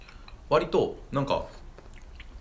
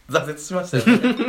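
laughing; 6 semitones higher, 8 dB louder than usual. Real, not acted. Laughing about giving up.

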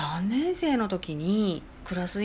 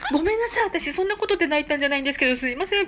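flustered apology; 7 semitones higher, 3 dB louder, faster than usual. Retold or imitated. Inner apology while crying.

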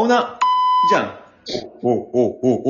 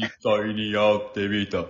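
much lower, soft caption narrator; 11 semitones lower, 6 dB quieter, slower than usual. Acted.

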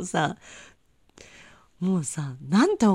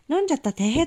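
cute oops; 7 semitones higher, 4 dB louder, faster than usual. Retold or imitated. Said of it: "とか言えばいいところ".

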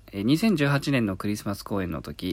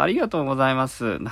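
voice cracking; 6 semitones higher, 5 dB louder, faster than usual. Real, not acted. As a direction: voice flips up and cracks. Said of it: "声がひっくり返っちゃった".

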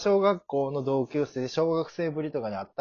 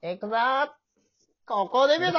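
high, loud heroic declaration; hammed-up debut hero; 14 semitones higher, 6 dB louder than usual. Acting out the debut scene for his partner, hammed-up hero. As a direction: play the anime hero about to go all out. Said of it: "acted debut line".

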